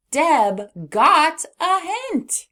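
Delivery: slow, high, loud exclamation; excited reading. Shown as a demonstration. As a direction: read it with an exclamation to your voice. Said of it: "exclamation to our voice".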